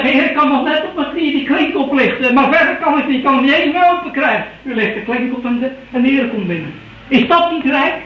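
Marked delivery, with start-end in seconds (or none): 0.0-4.4 higher, strained plea to the Lord; weak pleading sinner. Retold or imitated. A dying sinner calls to the Lord, higher pleading voice. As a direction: beg like a sick man at the door.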